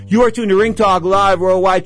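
loud, high, pumped-up station ID; radio announcer. Acted. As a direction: hype the show like a DJ over music. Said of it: "If I'm talking too fast, too much coffee".

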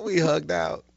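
ordinary delivery

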